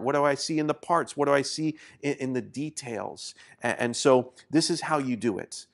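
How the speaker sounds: ordinary talk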